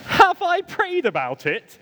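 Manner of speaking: slow, high, incredulous repeat; indignant echo. Retold or imitated. Throwing the friend's prayer question back, indignant.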